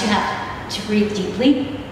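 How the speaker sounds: ordinary delivery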